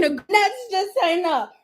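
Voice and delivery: usual speaking voice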